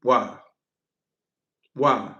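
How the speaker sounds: normal talking voice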